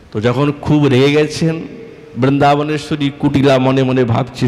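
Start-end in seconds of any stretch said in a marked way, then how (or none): none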